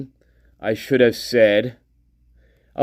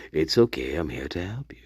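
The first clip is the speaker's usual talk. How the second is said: low, hushed device voice; low put-on assistant voice; mock Alexa; 8 semitones lower, 8 dB quieter, faster than usual. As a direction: drop low and soft, as a creepy fake assistant. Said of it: "it probably was the Alexa".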